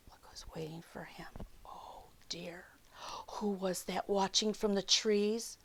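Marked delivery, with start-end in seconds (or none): 0.1-2.6 hushed, worried whisper; spooky whisper. A narrator's reading. Reading to children, a worried whisper. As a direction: warn the kids in a secret whisper. Reader warns kids of fox.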